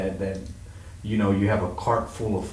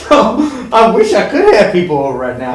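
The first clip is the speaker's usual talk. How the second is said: much higher and louder, laughing through the words; laughing excitedly; 11 semitones higher, 10 dB louder than usual. Real, not acted. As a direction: brag gleefully, laughing as you list it. Bragging about food, laughing.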